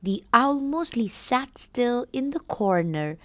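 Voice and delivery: put-on slow, careful reading aloud to children; story reading. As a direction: read it slowly for the class.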